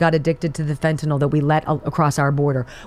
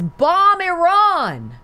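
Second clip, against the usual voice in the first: slow, high, drawn-out mock quote; slowly mocking, pitched up high; 12 semitones higher, much slower than usual. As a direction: stretch it out mockingly. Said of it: "who says".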